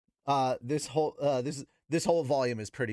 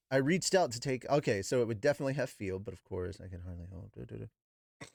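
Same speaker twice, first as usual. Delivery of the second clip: plain reading voice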